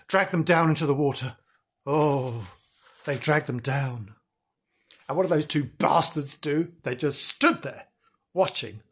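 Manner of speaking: ordinary delivery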